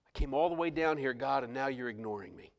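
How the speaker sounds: quiet, low, dejected prayer; hushed and let down; discouraged complaint. Retold or imitated. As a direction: complain softly to God, deflated. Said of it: "discouragement and confusion began to enter in".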